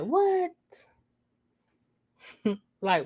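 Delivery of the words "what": much higher, soft, sassy; sassy what with hair roll. Acted. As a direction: flip it with attitude. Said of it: "put that hair roll in it".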